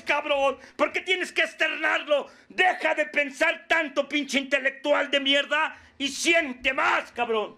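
high, forceful harangue; impassioned scolding. Acted. Scolding someone for not letting themselves cry, impassioned harangue. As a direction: drive it home, shouting at the peaks. Acted scolding about holding back tears.

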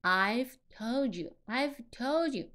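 "told you" run together as "tol-joo"; linked d and y. Shown as a demonstration. Teacher modelling the whole phrase, linked "told you". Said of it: "D和Y在一起".

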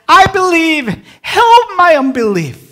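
loud, high, pleading cry; desperate cry. A narrator's reading. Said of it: "cried out and said".

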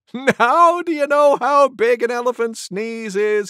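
high, loud, gleeful bird voice; laughing cuckoo. A narrator's reading. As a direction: crow the question in high amusement. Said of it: "Cuckoo began to laugh".

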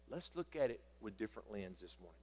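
ordinary talk, nothing marked

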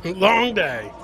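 normal talking voice

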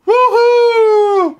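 high, drawn-out cheer; celebratory whoop. Real, not acted. Parent praising Wiggles for a good job, whooping. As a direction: whoop it up in triumph.

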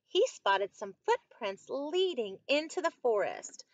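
expressive picture-book narration; storybook read-aloud, slow and lilting at the start; story reading to children. A narrator's reading. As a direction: stretch out the repeated words for young listeners.

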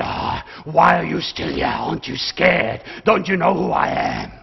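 loom over him like the devil in a pulpit joke; Satan's voice. Acted. Preacher voicing Satan in a church joke, mock menacing.

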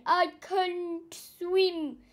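slow, high drowning plea; slow high mock panic; panicked wail. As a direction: drag it out in panic. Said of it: "he screamed".

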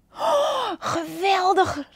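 high, drawn-out oh of delight; delighted cry. Acted. Panda seeing lemurs hop, slow and amazed. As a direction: marvel at the jumping lemurs. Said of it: "riep Panda".

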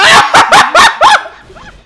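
high, loud burst of laughter. Real, not acted. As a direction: howl with laughter. Laughing at own fail.

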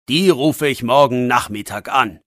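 usual speaking voice

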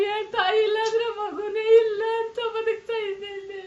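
a mother wailing and begging through tears; tearful, sing-song wail of a mother begging for her son; crying plea. Real, not acted. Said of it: "ಅಳ್ಬೇಡಿ, ಅಳ್ಬೇಡಿ".

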